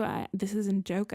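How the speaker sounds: normal talking voice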